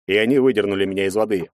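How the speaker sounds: ordinary delivery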